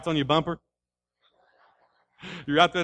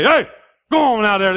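sharp, loud holler; acted calling out; 7 semitones higher, 11 dB louder than usual. Owner coming outside to the dog, loud holler. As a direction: call off the dog from the porch.

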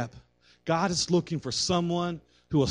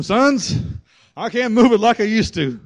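lightly voiced crippled old man, higher pitch on the first word; frail old man; 5 semitones higher, 5 dB louder than usual. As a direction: play a hint of an aged father addressing his boys. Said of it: "this man who's blind and crippled".

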